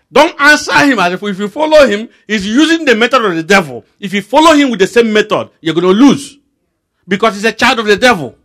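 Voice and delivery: high, loud rebuke; preacher scolding a doubter, loud and high; fiery preaching. Acted. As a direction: preach it hard, building. Preaching at full force.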